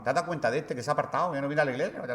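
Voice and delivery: quick, hushed churchgoer's gossip; judgmental gossip. Retold or imitated. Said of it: "empezamos a juzgar".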